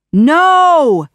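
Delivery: long, high, loud wail of protest; anguished cry. A narrator's reading. Jafar gets trapped in the lamp, crying out loudly. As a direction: cry out in defeat. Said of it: "cried Jafar".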